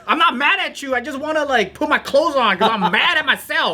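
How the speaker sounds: high-pitched retold scream, laughing through it. Man yells at his locked-out girlfriend, laughing mock scream. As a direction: insist you're not mad while shrieking it. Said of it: "He said while screaming".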